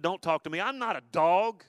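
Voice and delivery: hammed-up offended retort; mock indignant protest. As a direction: act out an offended woman snapping back. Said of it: "She could have said".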